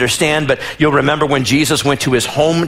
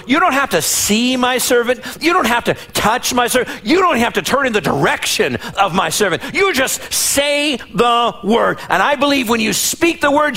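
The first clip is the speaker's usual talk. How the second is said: pleading centurion; 7 semitones higher than usual. Acted. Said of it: "he goes before Jesus and says".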